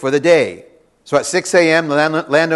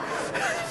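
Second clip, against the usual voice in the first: short breathy laugh at own joke; a quick amused chuckle; soft unvoiced laugh; light laugh; 15 dB quieter than usual. Real, not acted.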